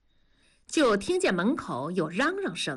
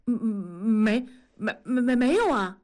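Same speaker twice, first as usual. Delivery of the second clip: flustered stammer; 3 dB louder than usual. Acted. Husband caught out by his wife, stammering nervously. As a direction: stumble over the word before getting it out.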